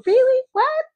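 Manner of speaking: high, squeaky mock disbelief; imitating a friend caught off guard; shocked disbelief. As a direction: shoot up in pitch like you can't believe it. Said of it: "She's like".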